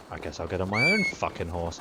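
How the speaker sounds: normal talking voice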